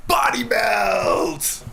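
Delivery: drawn-out shouted title; slow shout. Pretend. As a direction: hold it out like a chant.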